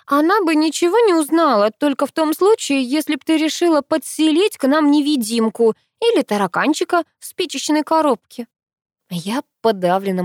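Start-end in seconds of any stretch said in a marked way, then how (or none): 0.0-8.5 high, loud sarcastic tirade; indignant. A narrator's reading. Sister mocks the plan to hide a guest, indignant. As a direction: pile on the scorn, pitched high. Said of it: "возмущалась Ангелина".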